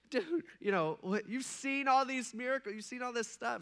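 high-pitched acted exasperation; mock-whiny incredulous pleading; frustrated disbelief. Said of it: "I wonder if Jesus felt like that".

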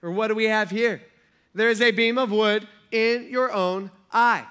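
loud teasing look-at-that; joking. Retold or imitated. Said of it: "he's kinda joking here".

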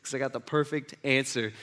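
quietly self-satisfied, low and soft; smug. Acted. Religious expert pleased to be asked by Jesus, quietly smug. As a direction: play a puffed-up know-it-all under your breath. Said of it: "He probably puffs out his chest".

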